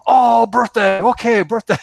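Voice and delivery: light it up as it finally clicks; a bright oh of getting it, played up; excited sudden understanding. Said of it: "He goes".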